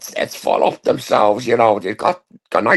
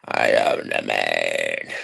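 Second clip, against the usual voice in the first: much lower, slow, rumbling fake voice; put-on deep voice; 5 dB quieter, much slower than usual. Prank caller boasting to the streamer in a fake deep voice. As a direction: drop it way down like a hard man. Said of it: "Nobody knows my real voice on here".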